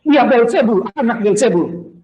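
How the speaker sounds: retold accusing shout; shouting crowd. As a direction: hurl it like a heckling mob. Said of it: "Ketika orang teriak-teriak".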